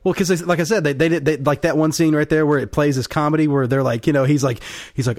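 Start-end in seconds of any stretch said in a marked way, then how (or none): none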